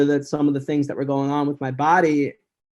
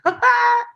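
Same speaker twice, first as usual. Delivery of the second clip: high, loud put-on laugh; an awkward forced ha-ha; fake laugh; 7 dB louder than usual. Greeting the bar owner who pours a shot, forced laugh.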